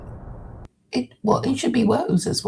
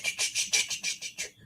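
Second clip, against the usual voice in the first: unvoiced chugging train noise; whispered train chugging; 12 dB quieter, faster than usual. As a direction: breathe out a little steam-train chug. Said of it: "I was expecting it to go ch".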